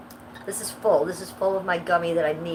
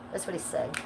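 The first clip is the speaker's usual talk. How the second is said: ordinary talk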